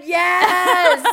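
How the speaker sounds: hammed-up, high, loud yes; excited imitation. Quoting a friend's reply to the Disneyland invite, mock elation. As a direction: shout it out like an overjoyed friend.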